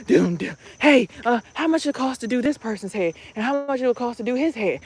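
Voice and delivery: barge in like a pushy mom asking prices; mock pushy mom bursting into the kitchen; hurried eager mom. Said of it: "she running in the kitchen all fast".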